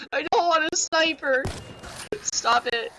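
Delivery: high-pitched, helpless protest; exasperated whine. Real, not acted. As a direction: plead with the game in a high voice. Protesting the weapon the game gives.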